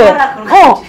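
ordinary talk, nothing marked

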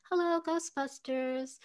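a light take on a receptionist answering the phone; breezy, lightly voiced phone greeting; audition read. Retelling her first audition read, a lightly voiced receptionist greeting. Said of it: "I just sort of was like".

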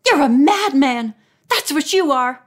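high, loud accusation; outraged shout. A narrator's reading. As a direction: hurl it at him, outraged. Woman shouts at her attacker.

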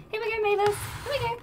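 high coaxing pet voice; calling the cat. Real, not acted. Streamer calls her cat Mavis over in a high coaxing voice.

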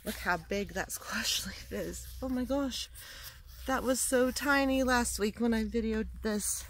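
ordinary delivery

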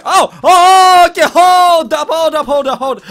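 sudden loud panic; startled, yell it out; alarmed. Real, not acted. Chased by two enemies.

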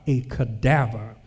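usual speaking voice

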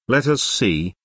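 ordinary delivery

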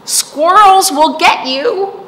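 high, loud warning voice; Oak warning. A narrator's reading. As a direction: warn the little acorn sharply. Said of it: "said Oak".

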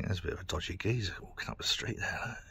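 hushed aside about a man outside; whisper. Real, not acted. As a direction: whisper so he won't hear. Spotting suspicious man outside.

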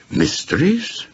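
high, startled question; sudden interest. Acted. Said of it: "with sudden interest".

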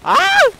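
very high, loud yelp; loud high-pitched scream; sudden yell. Real, not acted. Player crying out mid-match, sudden yell.

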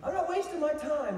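high-pitched king waving someone off; dismissive king. Acted. King to his servants about mad David, dismissive and high. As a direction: act the exasperated king, pitched well up. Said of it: "the king looked at David and said to his servants".

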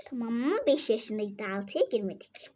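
ordinary talk

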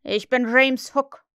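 loud, slow proclamation; Hook's voice. A narrator's reading. Peter poses as Hook from hiding, loud slow proclamation. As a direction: announce the name grandly. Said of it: "antwortete die Stimme".

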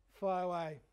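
ordinary delivery

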